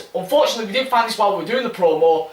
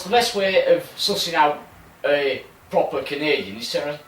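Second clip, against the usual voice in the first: normal talking voice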